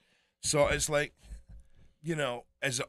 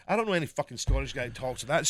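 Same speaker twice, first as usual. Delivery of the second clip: ordinary talk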